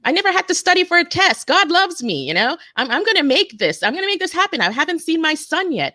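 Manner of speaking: high, urgent mock yell; retold yelling. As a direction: yell it out in desperate faith. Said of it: "I started yelling".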